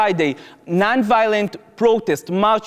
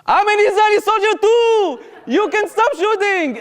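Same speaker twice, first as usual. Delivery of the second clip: mocking echo; 12 semitones higher than usual. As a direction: parrot the panicked scream back at him.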